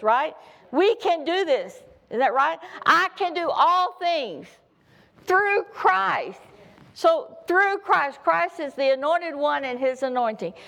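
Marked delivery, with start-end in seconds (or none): 2.9-8.2 high, slow, building preach; fervent preaching. Acted. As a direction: lift the scripture to the crowd. Preaching scripture.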